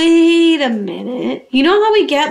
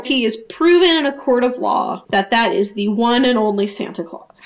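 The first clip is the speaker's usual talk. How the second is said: normal talking voice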